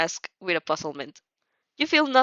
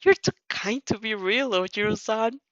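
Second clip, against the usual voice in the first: usual speaking voice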